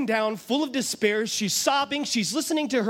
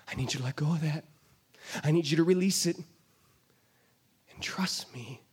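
soft, low, fading into a whisper; gentle voice of God; 8 semitones lower, 5 dB quieter, faster than usual. Acted. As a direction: coax them gently to let go. God speaks to the listener.

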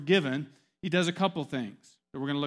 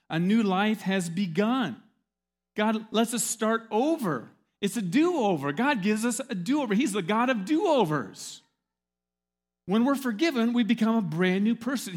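loud, high, slow preaching climax; rousing sermon, louder and higher; 5 semitones higher, 6 dB louder than usual. Acted. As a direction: proclaim it to the whole church. Preaching at full force.

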